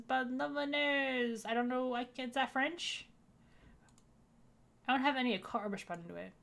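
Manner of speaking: ordinary delivery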